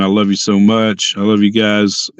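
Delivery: ordinary talk, nothing marked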